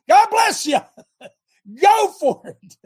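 high loud laugh through words; laughing blessing. Real, not acted. Host laughing while blessing good pastors, high and loud. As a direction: chuckle the blessing.

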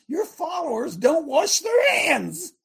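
high, slow, shouted accusation; indignant shout. Acted. Preacher voicing the scribes accusing Jesus, high slow shout. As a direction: hurl the charge at Jesus like an outraged scribe.

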